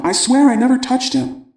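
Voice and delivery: put-on a kid swearing he's innocent; upset son. Son protesting to his father.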